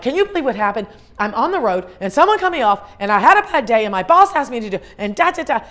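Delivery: loud, high run-on tirade; mock venting rant. Caller dumping a bad day on a friend, ranting. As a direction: pour out complaints without a break, loudly.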